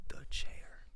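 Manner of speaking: hushed dramatic title reveal; spooky whisper. Pretend. Announcing a scary title.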